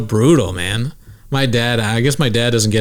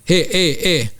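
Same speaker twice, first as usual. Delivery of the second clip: high mock-Jamaican calls; fake Jamaican accent; 7 semitones higher, slower than usual. Imitating the comic addressing his crowd, high fake Jamaican accent. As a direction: call out in a put-on Jamaican accent. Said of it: "he's like".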